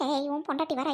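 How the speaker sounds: ordinary talk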